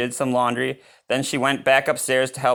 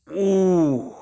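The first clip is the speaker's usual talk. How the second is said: high delighted ooh; 8 semitones higher than usual. Real, not acted. Reader admiring the breakfast picture, delighted. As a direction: marvel at the picture.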